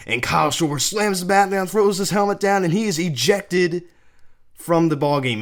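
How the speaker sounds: loud, high-pitched highlight call; excited play-by-play. Real, not acted. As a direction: build up the ejection like a big moment. Calls the ejection as highlight.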